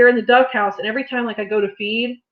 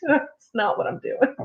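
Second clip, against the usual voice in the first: ordinary talk, nothing marked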